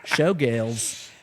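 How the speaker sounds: broad Australian twang on "Showgirls"; Australian accent. Acted. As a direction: imitate the Australian film line. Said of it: "I can emulate it".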